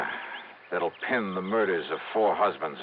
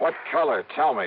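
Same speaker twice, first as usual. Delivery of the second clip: sharp and much higher, pressing hard; urgent demand; 8 semitones higher, 3 dB louder than usual. Acted. Johnny pressing Betty about her hair, urgent high demand. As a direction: demand an answer, pitch shooting up.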